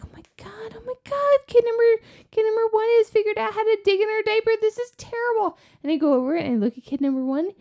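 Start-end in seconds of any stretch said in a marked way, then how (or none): 0.0-0.8 high, breathless whispered gasp; horrified whisper. Retold or imitated. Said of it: "I was like". 0.8-5.6 high, fast, frantic alarm; panicked. Retold or imitated. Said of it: "I was like".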